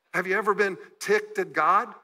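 ordinary talk

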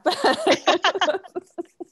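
high burst of laughing, mouth full; embarrassed laugh. Real, not acted. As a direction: giggle through a mouthful. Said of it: "scone in my mouth".

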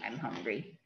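little bear only thinks he's hungry, hushed and low; thinking to himself. A narrator's reading. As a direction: drop to a soft low murmur as if only thought. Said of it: "Well, actually, it's thinking".

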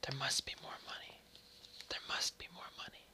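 very soft, fully whispered; haunting whisper. A narrator's reading. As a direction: breathe it as a secret, unspoken voice. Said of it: "It came whispering from the springs".

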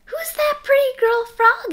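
high, loud teasing mother; a delighted high-pitched tease. A narrator's reading. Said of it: "asked his mother".

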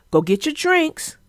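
ordinary delivery

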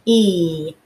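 E said as its name; E held as a clear long ee; long E sound. Shown as a demonstration. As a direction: say the letter name E. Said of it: "You hear the C".

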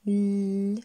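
"L" as one long, loud lll; isolated consonant sound. Shown as a demonstration. Teacher introduces the fourth syllable, consonant "L" held long. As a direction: hold the sound of "L", not the letter name. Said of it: "On arrive à la quatrième syllabe".

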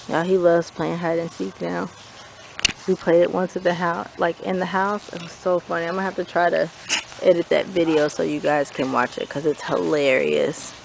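low, soft confiding to camera; hushed aside. Real, not acted. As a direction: keep it down, confide to the viewers. Aside to camera while searching.

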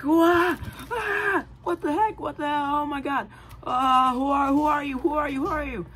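very high, frantic questions; high panicked teen voice; panicked yelling. Acted. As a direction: shriek in alarm at a stranger. Richard waking in panic.

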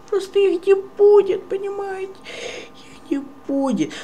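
very high, exasperated squeak; raging gamer. Real, not acted. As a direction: squeak it in disbelief. Exasperated insisting there are no spoilers.

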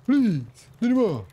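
high, slow whiny please; mock pleading. Streamer begging teammates for the stone, mock whine. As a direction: beg them like a child.

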